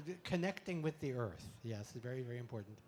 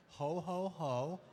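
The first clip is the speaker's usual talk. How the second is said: belly laugh demo; much slower than usual. Shown as a demonstration. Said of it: "We have belly laughter down here".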